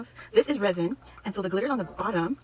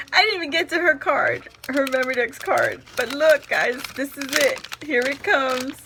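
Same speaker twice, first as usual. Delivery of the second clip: loud, bright-eyed announcing; excited buildup; 3 semitones higher, 8 dB louder than usual. Real, not acted. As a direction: hype up the reveal. Building excitement over next item.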